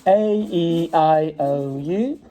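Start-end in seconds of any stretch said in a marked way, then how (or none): none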